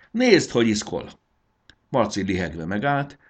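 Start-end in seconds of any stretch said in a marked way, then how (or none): 0.1-1.2 high, loud exclamation; gleeful call. A narrator's reading. Marci shows Fanny the fleeing caterpillar, calling gleefully. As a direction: call out, pleased at the rout. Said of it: "kiabált Fanninak".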